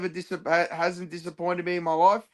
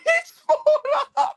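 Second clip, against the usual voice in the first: laughing; much slower than usual. Real, not acted.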